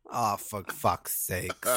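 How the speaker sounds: low, soft, slow mock grumble; imitating Brenda from Bristol. Reacting to Rita Baga winning, with a low mock grumble. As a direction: mutter it, utterly fed up. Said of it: "Brenda from Bristol".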